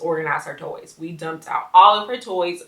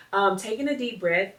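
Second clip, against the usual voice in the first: normal talking voice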